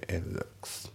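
"it looks" shortened to "i'looks"; "it looks" linked with a silent t; dropped T. Shown as a demonstration. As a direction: leave the t off "it" and slide into "looks". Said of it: "itのTがね".

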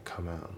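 soft "come and" linked as "kaman"; linking. Shown as a demonstration. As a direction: run "come and" together as one soft word, no D. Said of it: "come andのところがリンキングで".

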